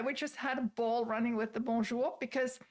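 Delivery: ordinary talk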